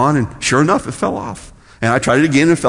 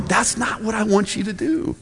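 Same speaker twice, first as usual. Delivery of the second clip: higher, softer gentle correction; voicing Jesus; 6 semitones higher, 8 dB quieter than usual. Jesus correcting those who pray for show, soft admonishment. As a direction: quietly set them straight. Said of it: "And Jesus is going".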